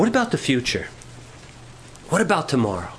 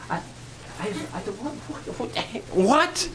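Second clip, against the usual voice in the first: breathless stammer building to a high, shocked "what?"; whispered stammer into a high shocked what; stunned disbelief; 4 semitones higher, 6 dB quieter, slower than usual. Retold or imitated. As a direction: trip over the words, then burst out high in shock. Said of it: "stunned reaction".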